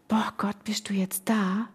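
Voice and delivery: slow, near-whispered wonder; hushed awe. Retold or imitated. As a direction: whisper it slowly, as if to God. Awed question to God, retold.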